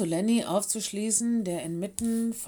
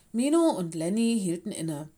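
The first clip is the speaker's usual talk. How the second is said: usual speaking voice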